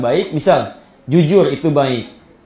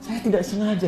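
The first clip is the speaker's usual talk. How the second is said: soft, higher-pitched pleading; small, understated tearful voice; tearful apology, imitated; 4 semitones higher, 6 dB quieter than usual. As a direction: act out a remorseful wife excusing herself. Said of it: "dia nangis".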